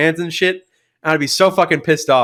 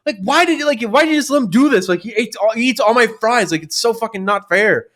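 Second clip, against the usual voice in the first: high, whiny tattling to mom; whiny complaining sister; 8 semitones higher than usual. Retold or imitated. Sister complaining to their mom in a high whine. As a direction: whine it out like a wronged kid. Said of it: "go to my mom, like".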